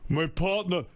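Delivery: high-pitched griping dad; Dad starting his complaint, voice pushed up; dad impression. Father starting in about his partner, high griping voice.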